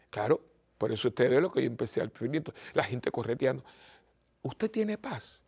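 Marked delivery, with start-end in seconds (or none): 4.4-5.4 retreat question. Shown as a demonstration. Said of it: "Le voy a terminar con, como con retiro".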